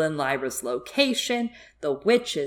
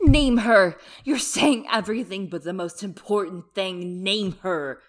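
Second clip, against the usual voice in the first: loud, insistent demand; crying out; 4 semitones higher, 7 dB louder than usual. A narrator's reading. Mrs Coulter pressing the tortured witch for a name, crying out. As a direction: cut in forcefully, raised voice. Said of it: "cried Missus Coulter".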